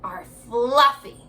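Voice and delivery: slow, deliberate picture-book reading; unhurried storybook voice for young listeners; reading to children. Acted. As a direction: read it slowly to little kids. Reads the picture book aloud.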